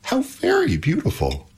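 high, admiring wonder; exclaiming. A narrator's reading. Pandora admiring the fairy-like stranger, exclaiming. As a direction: marvel in a high voice. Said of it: "exclaimed Pandora".

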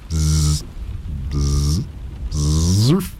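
three buzzes, last one rising; bee buzz. A narrator's reading. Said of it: "Habit was sadly stronger than he".

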